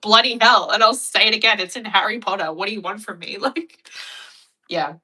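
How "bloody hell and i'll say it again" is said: loud, cheeky bloody hell tossed at dad; cheeky defiance. Retold or imitated. Kid defying her dad over a swear, loud and cheeky. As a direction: fling it back at him as a dare. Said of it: "And I was just like".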